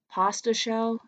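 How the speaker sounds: ordinary talk, nothing marked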